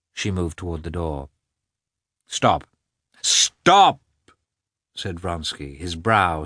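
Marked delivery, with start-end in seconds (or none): 2.3-4.0 second Stop much higher and louder; urgent call. A narrator's reading. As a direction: call her back, sharper the second time. Vronsky halts her leaving.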